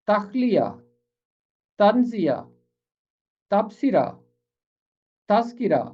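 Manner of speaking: list-like drill from तजरिबा to तस्किरा, each word set apart; pronunciation drill. Shown as a demonstration. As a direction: model तजरिबा and its rhyming words one by one, long pauses between. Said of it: "जिनका तलफ़ुज़ है इस तरह".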